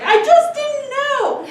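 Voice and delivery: high, loud, drawn-out mock protest; retold protest. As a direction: plead it way up high, stretching the words. Retold excuse of scolded person.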